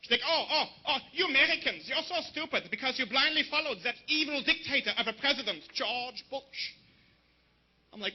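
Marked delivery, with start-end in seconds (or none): 0.3-6.7 high, indignant mock German woman; hammed-up German liberal; German accent. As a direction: scold the Americans in a thick German accent. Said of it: "her mom is German, typical classic German European liberal".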